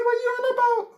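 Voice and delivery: high, laughing, incredulous retort; laughing disbelief. Retold or imitated. Prisoner laughing back at the officer, high incredulous retort. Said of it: "I said".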